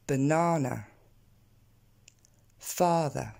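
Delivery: BANANA as buh-NAA-nuh, FATHER as FAA-thuh, spaced apart; model for repetition. Shown as a demonstration. Teacher having learners repeat these two, model English pronunciation. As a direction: give BANANA and FATHER separately with long stressed A. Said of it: "On va répéter ces deux-là ensemble".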